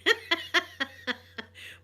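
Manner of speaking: high, bright cackle of glee; a gloating little laugh over the football; gleeful laugh. Real, not acted. Laughing as she teases the Rangers fans, gleeful.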